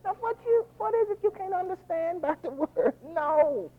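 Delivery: high, soft, exasperated parent voice. Acted. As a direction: sound like a fed-up parent asking again. Preacher mimics exasperated parent.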